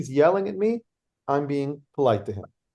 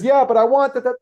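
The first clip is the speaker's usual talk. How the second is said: higher, louder mock insistence; insistent heckler yell; 8 semitones higher, 7 dB louder than usual. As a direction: push back like a stubborn heckler.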